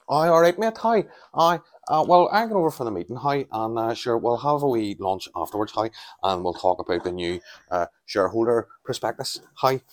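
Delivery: high, exaggerated Northern Irish accent; Northern Irish businessman. Northern Irish businessman arranging a meeting, broad hammed-up Northern Irish accent. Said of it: "then you get a Northern Irish businessman".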